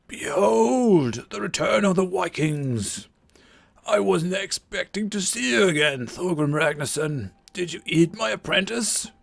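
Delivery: put-on a theatrical old druid greeting; old wizard voice. Game master voicing Merlin greeting the Vikings, old wizard voice. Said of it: "the voice that I'm giving him".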